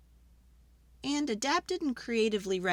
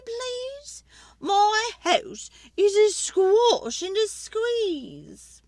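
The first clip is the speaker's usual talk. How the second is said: put-on high, loud complaining old lady; fretful old lady; 12 semitones higher, 4 dB louder than usual. Old lady begging the wise man for help, high and pleading.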